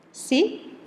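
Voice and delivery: ordinary talk, nothing marked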